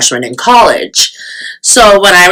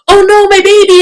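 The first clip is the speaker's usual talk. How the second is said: very high mock wail; distraught mother; 3 dB louder than usual. French mother crying out over her baby, mock distraught. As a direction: imitate a panicked mom, pitched way up. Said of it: "and she's like".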